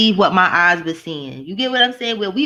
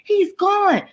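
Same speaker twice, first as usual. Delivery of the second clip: high, gleeful mock cheer; 10 semitones higher, much slower than usual. Imitating people celebrating Trump leaving, high gleeful cheer. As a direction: squeal it like a celebrating crowd. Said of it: "everybody was like".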